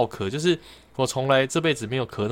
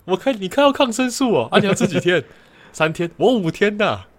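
bragging coworker; 7 semitones higher, 4 dB louder than usual. Retold or imitated. Coworkers comparing medicine bags.

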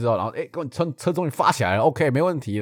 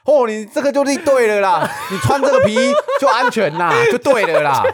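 old man advising about the leather suit, hammed up; imitating the old man; 11 semitones higher, 7 dB louder than usual. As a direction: lecture like a fussy old uncle. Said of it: "口气就是这样子".

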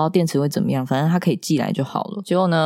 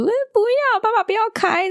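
put-on very high shrieking toddler's no; 模仿小孩尖叫; imitating a child screaming. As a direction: mimic a girl shrieking at dad. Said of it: "这样会尖叫".

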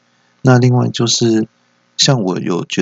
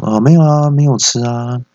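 high, louder mock-innocent denial; quick denial; 4 semitones higher, 4 dB louder than usual. Father and son cover up snacks to mom, mock-innocent denial. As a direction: deny it at once, pitched up. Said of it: "我们两个都异口同声说".

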